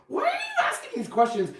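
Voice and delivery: high, laughing mock-confused squeal; laughing question. [name] retells quizzing husband, high and laughing. Mocking his own confused self.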